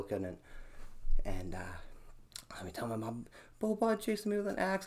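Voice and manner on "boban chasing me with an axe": put-on high, panicked child tattling to mom; mock-tearful high plea to mom; crying kid. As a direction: whimper it out like a scared little kid. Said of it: "I think I was crying".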